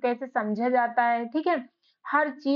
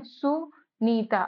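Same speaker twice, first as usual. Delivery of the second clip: drawn-out Sunita, each syllable stretched; slow and spelled out; much slower than usual. Shown as a demonstration. Teacher spelling Sunita out slowly for beginners. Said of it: "हम अलग-अलग लिख के बताते हैं".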